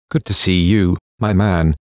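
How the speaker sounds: plain reading voice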